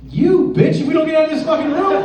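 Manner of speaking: loud, high-pitched comic fury; acted angry outburst. Man to his date stuck in escape room, hammed-up angry yell. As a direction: blow up at your date, voice shooting up.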